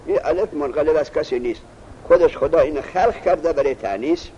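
ordinary delivery

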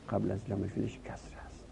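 fast, whispered aside; quick whispered repeat of the rule. Real, not acted. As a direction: murmur the rule to yourself.

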